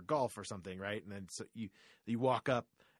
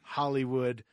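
louder, higher announcer voice; podcast outro; 3 semitones higher, 6 dB louder than usual. Acted. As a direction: hype up the sign-off like a radio host. Hosting the outro.

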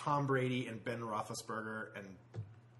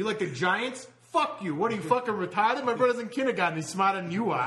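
loud, aggressive jeering fan voice, a bit higher; brash mock fan taunting a Giants supporter; mock Boston sports fan; 5 semitones higher, 6 dB louder than usual. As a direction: heckle like an obnoxious Boston fan. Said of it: "You're like".

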